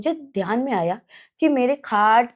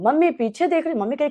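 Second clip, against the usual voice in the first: normal talking voice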